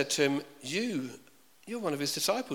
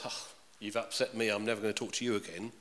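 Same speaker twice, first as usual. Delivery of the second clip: sulky friend; 4 semitones lower, 7 dB quieter than usual. Retold or imitated. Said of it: "that friend that says".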